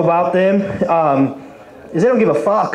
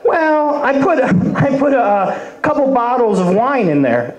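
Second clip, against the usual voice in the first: sheepish mother confessing; 6 semitones higher than usual. Retold or imitated. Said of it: "she goes".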